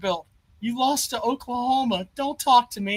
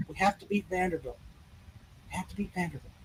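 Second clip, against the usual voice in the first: softer, a sing-song chant to himself; mock bedtime mantra; 5 semitones lower, 13 dB quieter than usual. Host putting a taunt in the caller's head, softer and mocking. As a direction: murmur it like a bedtime reminder. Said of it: "go to bed tonight and think".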